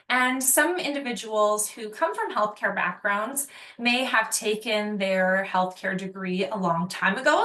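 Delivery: ordinary talk, nothing marked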